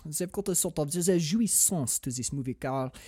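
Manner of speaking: put-on soft, murmuring French accent; put-on French accent, savoring the words. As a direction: rhapsodize quietly like a French cinephile. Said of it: "I base my French accent off of".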